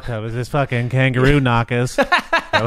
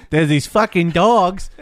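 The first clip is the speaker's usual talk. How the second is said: mock Aussie driver; 5 semitones higher, 4 dB louder, slower than usual. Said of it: "He's like".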